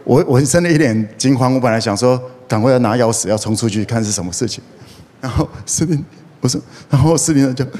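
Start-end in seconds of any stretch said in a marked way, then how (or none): none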